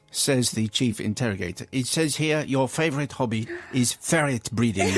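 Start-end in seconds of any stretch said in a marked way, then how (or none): 1.7-4.9 lightly put-on German interviewer accent; a stiff German interviewer reading out the CV. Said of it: "it demands the German accent".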